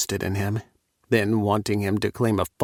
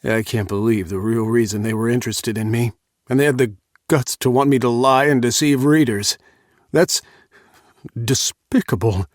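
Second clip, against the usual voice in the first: indignant outburst growing louder; angry; 2 semitones higher, 5 dB louder than usual. A narrator's reading. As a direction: vent the outrage, building up. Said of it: "just as angry as me".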